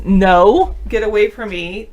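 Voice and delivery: loud, firm no; firm rejection. Retold or imitated. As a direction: push him away firmly. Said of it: "If she says".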